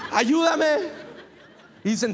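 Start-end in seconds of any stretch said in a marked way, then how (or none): 0.1-1.0 pleading face. Retold or imitated. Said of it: "Con mi cara".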